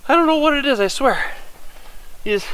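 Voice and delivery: ordinary talk, nothing marked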